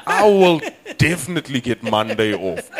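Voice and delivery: high, loud realisation; pleased, certain announcement; dawning triumph. Acted. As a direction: land the punchline with conviction. Punchline, first take.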